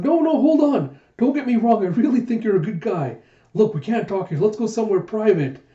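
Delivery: high, loud, acted pleading; urgent pleading. Jayha begging Huck not to leave, urgent pleading. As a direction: plead to stop someone walking off.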